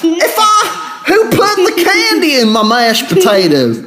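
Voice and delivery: very high, drawn-out sitcom hillbilly holler; Dukes of Hazzard character. Acted. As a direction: ham up a high-pitched country yokel. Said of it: "Dukes of Hazzard character or something".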